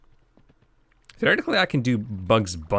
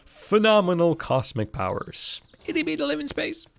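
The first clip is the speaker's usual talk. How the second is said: quoting the Aladdin genie; 12 semitones higher than usual. Retold or imitated. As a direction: ham up the genie's big line, then shrink it high. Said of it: "to quote, a genie from Aladdin".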